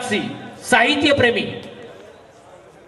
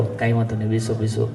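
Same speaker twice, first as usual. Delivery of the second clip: soothing doctor; 10 semitones lower, 5 dB quieter than usual. Acted. Doctor soothes a fake patient.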